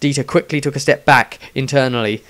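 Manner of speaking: plain reading voice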